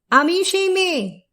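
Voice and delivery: high, proclaimed refrain that falls at the end; ringing declaration. Acted. The woman announcing herself to her accusers, ringing declaration. Refrain opens next stanza.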